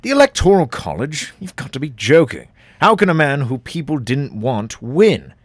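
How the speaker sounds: exasperated, pushing each line harder; indignant. Acted.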